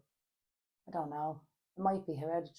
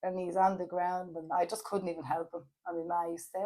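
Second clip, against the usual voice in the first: ordinary talk, nothing marked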